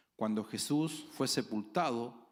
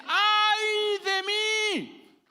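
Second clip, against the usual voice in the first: long, very high, loud shout of despair; broken sinner crying out when the Lord breaks him; anguished shout; 12 dB louder, much slower than usual. Acted. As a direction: let the cry break out of a broken man. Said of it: "lo primero que dice".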